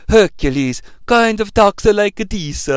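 slow, hammed-up Italian accent; mock Italian accent, drawn out; bad Italian dub accent. Shown as a demonstration. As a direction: talk like a badly dubbed Italian film hero. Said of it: "do a stereotypical Italian accent".

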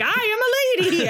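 high, prim mock protest; a lightly voiced huffy character; mock indignant. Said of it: "He's like".

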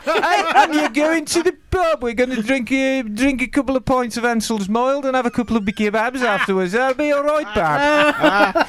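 high-pitched, thick Birmingham accent; broad Brummie accent. Shown as a demonstration. Brummie inviting a pal to the pub, broad Birmingham accent. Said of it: "I can do my Brummie accent".

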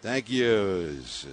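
slow, booming Brooklyn PA announcer; Brooklyn accent. Retold or imitated. Brooklyn announcer calls the batter to the crowd, in a slow, loud Brooklyn accent. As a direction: stretch each word out like an old Brooklyn ballpark announcer. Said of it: "he had this Brooklyn accent".

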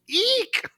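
a tiny squealing "Eek!"; high-pitched scream. Retold or imitated. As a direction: squeal like a scared guard. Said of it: "one of the guards just screams".

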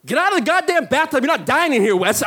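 high-pitched panicked self-order; acted frantic pep talk to yourself; urgent self-scolding. Acts out self-rousing panic.

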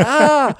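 high, sharp gasp of dismay; startled gasp. Retold or imitated. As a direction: let out a shocked little gasp. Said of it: "I was like".

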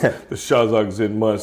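low, slow mystic tone; Razorblade. Acted. Wrestler naming the monks who trained him, low solemn voice. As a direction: intone it like a fallen champ. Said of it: "he goes".

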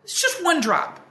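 high, mock-exasperated coaxing; a parent insisting it's only one drop; pleading parent. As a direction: act like it's no big deal, a little desperate. Parent pleading with reluctant kids.

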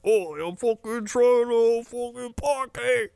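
very high, drawn-out British yob; British guy. Acted. British guy answering New York guy, high mock British holler. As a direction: whoop it in cartoon British. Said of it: "your British guy".

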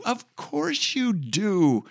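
mock answer. Host answering for the listener, mock high voice. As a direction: answer for them in a silly high voice.

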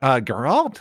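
ordinary delivery

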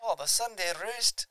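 put-on botched, high Scottish-English accent; slow, high muddle of Scottish and Irish accents; half-Scottish accent. Co-host echoing the segment jingle, bad half-Scottish accent. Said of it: "it was half Scottish".

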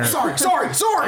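put-on high, fluttery bumbling old-film voice; a flustered, dithering fire spirit; Jimmy Stewart. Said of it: "that Jimmy Stewart fire elemental impersonation".

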